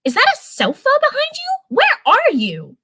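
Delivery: high, surprised fairy voice, slowing on the last question; a bubbly fairy caught off guard; Stella, surprised. A narrator's reading. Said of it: "Stella intervened".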